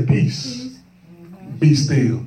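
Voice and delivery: measured command, dropping low on the end; Christ calming storm. Acted. Christ gets up to calm the disciples, commanding. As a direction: command calm, pausing between phrases. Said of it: "Christ get up and say".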